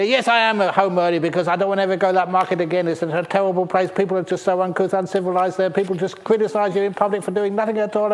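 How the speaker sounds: fast, loud and high, a flustered complaining husband; fast agitated rant. Acted. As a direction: rattle it off fast and loud to wake people up. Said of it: "Now, I say that fast, just it wakes people up".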